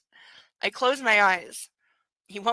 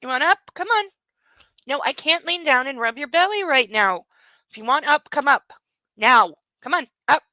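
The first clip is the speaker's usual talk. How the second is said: coaxing the puppy; 3 semitones higher, 3 dB louder than usual. Real, not acted. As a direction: coax the puppy up onto her lap. Coaxing the puppy onto her lap.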